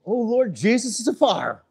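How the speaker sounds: loud, high startled cry; surprised exclamation. Real, not acted. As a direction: blurt it out in shock. Loud outburst at a demo.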